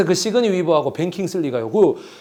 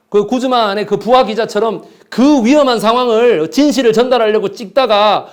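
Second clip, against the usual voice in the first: higher, louder, slowed and pressing; impassioned rant; 6 semitones higher, 5 dB louder than usual. Real, not acted. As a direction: hammer the point home. Impassioned lecture climax.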